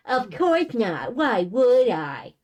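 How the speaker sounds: slow, loud indignant scoff. Acted. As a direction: scoff at the very idea. Denial, scoffing at the idea.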